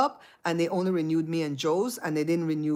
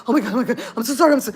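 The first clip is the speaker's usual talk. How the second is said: imitating a gushing, higher, louder apology; flustered apology; 6 semitones higher, 7 dB louder, much faster than usual. Woman apologizes for calling her sir, flustered. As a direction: apologies tumbling over each other.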